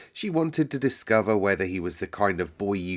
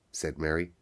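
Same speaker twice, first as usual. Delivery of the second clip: usual speaking voice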